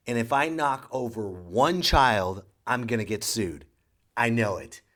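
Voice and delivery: ordinary delivery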